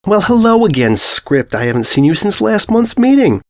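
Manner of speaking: high, bright, cheerful greeting; chirpy hello. Acted.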